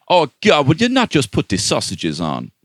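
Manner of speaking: loud, high bad Irish-Jamaican accent; mock a failed Irish accent that comes out Jamaican; botched Irish accent sliding Jamaican. Podcaster mocking an actress's Irish accent, mangled Irish-Jamaican impression. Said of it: "trying to do Jamaican".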